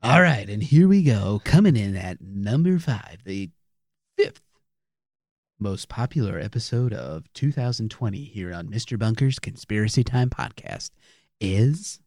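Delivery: lightly hyped countdown announcer; a hint of radio countdown host. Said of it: "your fabulous countdown voice?".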